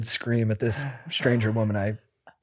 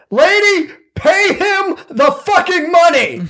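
much higher, much louder, slow furious yell; losing it; 10 dB louder, slower than usual. Retold or imitated. Fake lawyer snapping at the woman on the phone, furious yell. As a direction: explode at her, dragging out every word. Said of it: "I lost my mind halfway through".

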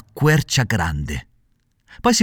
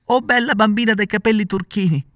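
shouting plea; 10 semitones higher than usual. A narrator's reading. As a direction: call up to the window desperately. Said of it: "gridava Pinocchio".

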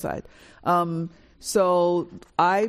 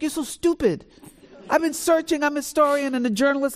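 mock exasperated scolding; 9 semitones higher than usual. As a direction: scold him in mock outrage. Sister scolding brothers, retold.

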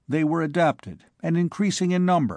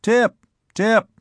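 loud, high calls into the wind; shouting a name; 9 semitones higher, 5 dB louder than usual. Acted. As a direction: shout the name, searching in the dark. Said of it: "he called".

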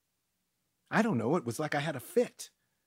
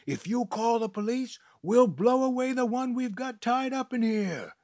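high, loud threatening yell; yelling back; 10 semitones higher, 5 dB louder than usual. Acted. Garcia threatening the people outside, loud yell. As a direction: shout the threat back through the door. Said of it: "yelled back".

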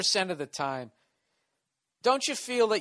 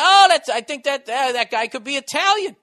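loud, high mock guess; mock realization; 10 semitones higher, 12 dB louder than usual. Comedian playing a clueless listener, loud mock realization. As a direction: blurt out a hammed-up wrong guess.

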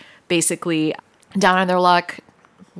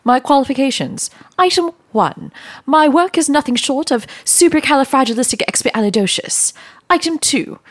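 prim high nanny voice; 7 semitones higher, 3 dB louder than usual. Acted. Listing qualifications in high voice.

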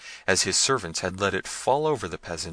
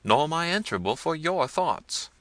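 firm, raised reply; boldly; 8 semitones higher than usual. A narrator's reading. Peasant answering the prince boldly. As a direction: stand up to him. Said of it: "replied the young man boldly".

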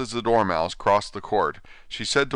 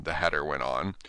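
usual speaking voice

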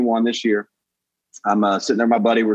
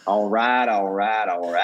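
low, laid-back catchphrase drawl; Matthew McConaughey; 5 semitones lower, slower than usual. Acted. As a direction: imitate an easygoing Southern movie star. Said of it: "do an impression of Matthew McConaughey".

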